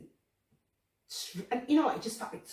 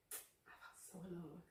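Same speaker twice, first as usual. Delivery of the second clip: much softer, almost breathed; hushed echo; 16 dB quieter than usual. Real, not acted. Telling viewers her loneliness, in a hushed echo. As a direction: let the words fall to a near whisper. Repeats the line twice.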